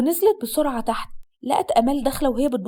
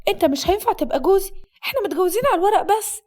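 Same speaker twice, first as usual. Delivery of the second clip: higher-pitched voice of a bride objecting; protesting bride; 6 semitones higher than usual. Acted. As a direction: protest as the alarmed young wife. Voices the alarmed bride in the story. (عروسة معترضة بصوت عالي الطبقة)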